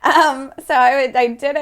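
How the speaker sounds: ordinary talk, nothing marked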